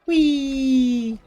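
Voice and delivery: high, loud playful whee; gleeful whee. Real, not acted. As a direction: squeal with delight on the ride. Riding up a ramp in game.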